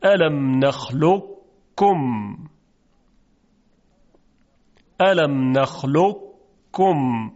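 الم نخلقکم slow, ق heaviness heard before کم; partial merger demo. Shown as a demonstration. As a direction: recite الم نخلقکم slowly, thick ق into the ک.

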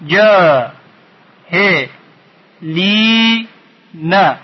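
"جا، ه، لی، نه" as four isolated beats; syllable by syllable. Shown as a demonstration. Teacher splitting the last word, syllable by syllable. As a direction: hold "جا" and "لی" long, pausing between parts. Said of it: "چهار بخش".

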